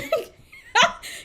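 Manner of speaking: loud, high cackle; burst of laughter. Real, not acted. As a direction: crack up mid-sentence. Cracks up at own accent.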